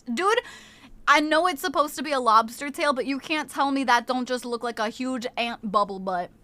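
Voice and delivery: usual speaking voice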